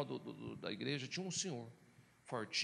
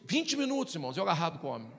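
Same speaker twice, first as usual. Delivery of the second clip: ordinary talk, nothing marked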